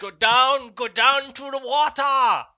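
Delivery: put-on loud, high, hammy Austrian accent; bad Austrian accent. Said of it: "the worst Austrian accent ever".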